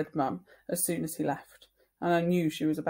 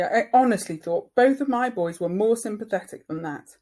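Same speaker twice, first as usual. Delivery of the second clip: angry; 4 semitones higher, 8 dB louder than usual. Acted. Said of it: "said Mum angrily".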